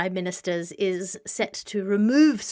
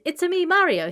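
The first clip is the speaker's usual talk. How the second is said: high cartoonish Italian accent; Mario; 11 semitones higher, 5 dB louder than usual. Quoting the game character, high cartoonish Italian accent. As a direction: squeak it as a cartoon Italian plumber. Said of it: "in a cartoonish Italian accent".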